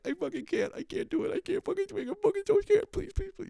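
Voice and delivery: very high squeaky pleading; mock panic, high-pitched begging; panicked whine. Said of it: "it was just ultimate panic".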